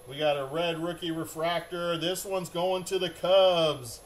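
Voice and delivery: usual speaking voice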